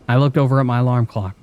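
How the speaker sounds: usual speaking voice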